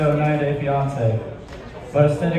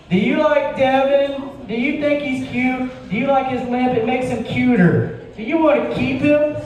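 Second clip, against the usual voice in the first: wife's voice; 9 semitones higher than usual. Retold or imitated. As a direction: coo at the ghost like a smitten wife. Wife voiced in ghost story.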